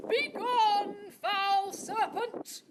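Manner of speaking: high, loud knightly cry; ringing knight's challenge; heroic challenge. Acted. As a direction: declaim it like a storybook knight.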